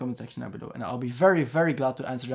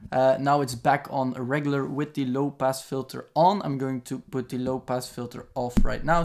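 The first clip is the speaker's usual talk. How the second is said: usual speaking voice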